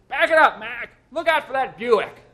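very loud, high, urgent yell; parking attendant hollering at Mitty. A narrator's reading. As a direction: shout a warning at the driver. Attendant yelling at driver.

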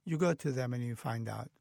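ordinary delivery

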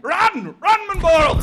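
high, loud cry of alarm; urgent warning shout. Acted. Anansi warns the hornet king, urgent shout. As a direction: yell it to make him flee.

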